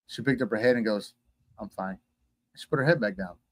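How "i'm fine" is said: low, very soft brush-off; subdued reply. Retold or imitated. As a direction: voice a withdrawn passenger, hushed and low. Downcast passenger brushes off concern.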